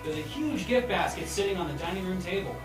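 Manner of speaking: normal talking voice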